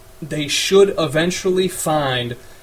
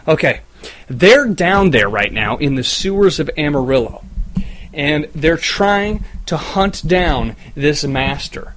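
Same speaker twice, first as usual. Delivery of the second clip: ordinary talk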